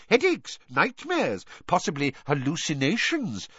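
high-pitched listing; high voice easing down over the list; quick. A narrator's reading. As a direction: rattle off the symptoms, pitched high. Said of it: "said Fudge quickly".

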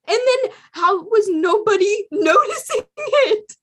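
high, loud, laughing through the words; laughing disbelief. Real, not acted. Fan laughing at nobody noticing, high and loud.

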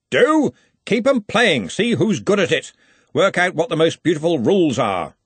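loud, high parting orders; calling out. A narrator's reading. Ridcully leaves the hall giving Ponder orders, calling out. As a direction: call instructions across a hall. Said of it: "Ridcully called out".

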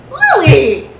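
high, loud, overjoyed greeting; excited tavern man. Acted. A gentleman rises from his table and greets Lily, thrilled. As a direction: shout her name in delighted surprise. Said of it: "and goes".

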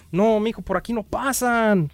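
high, loud señora, drawn-out ending; older local woman. Acted. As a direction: call it out high like a señora, stretching the end. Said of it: "le dije a una señora". (voz de señora, aguda, fuerte y alargada)

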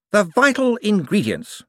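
high, eager, bright-voiced wizard; a jumpy official covering for himself. A narrator's reading. Hicks presenting the box.

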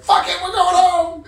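high, full-volume yell; loud, high, exasperated holler; acted scream. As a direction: scream it into the air. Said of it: "like, scream into the air".

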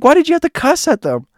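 loud, high-pitched reproach; retold exasperation. Narrator asks Joanne about cussing, exasperated. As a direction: demand an answer in high disbelief.

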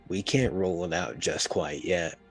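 ordinary talk, nothing marked

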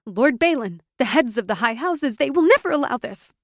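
high, loud, rushing in fear; fast frightened shrill protest; shrill and fearful. A narrator's reading. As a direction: voice climbing into panic. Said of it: "her voice had risen to a shrill, fearful register".

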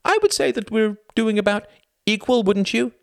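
high, loud sarcastic tirade; angry sarcasm. Acted. Spouse argues over roles of husband and wife, sarcastic. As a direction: lecture with withering indignation. Acted quarrel, biting sarcasm.

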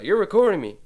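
loud, high accusing yell; Karen yelling. Retold or imitated. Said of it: "She's like a Karen".

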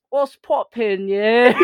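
imitating a friend's slow British greeting; British accent. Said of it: "And you're just like".

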